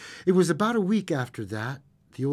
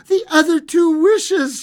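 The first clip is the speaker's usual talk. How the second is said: high, loud excited reply; feverishly eager; 9 dB louder than usual. A narrator's reading. Wife answers husband about the wishes, feverishly eager. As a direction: burst out with it eagerly. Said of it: "she replied rapidly".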